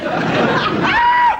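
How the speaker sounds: a sudden very high shriek; comic high yelp. Acted. Truck crash, high shriek. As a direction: let out a cartoon scream.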